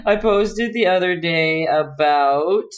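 ordinary delivery

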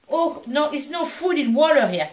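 usual speaking voice

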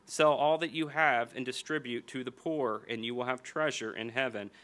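ordinary talk

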